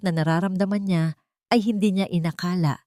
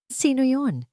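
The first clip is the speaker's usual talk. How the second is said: scared call; 8 semitones higher, slower than usual. A narrator's reading. Frightened woman at the door.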